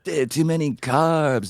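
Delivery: high surfer bro voice; a hint of a surfer bro; surfer dude. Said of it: "talks like a surfer dude".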